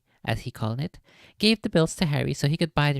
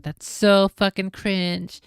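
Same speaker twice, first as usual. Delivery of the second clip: exasperated cringe; 4 semitones higher, 6 dB louder, slower than usual. Real, not acted. Reacting to the story.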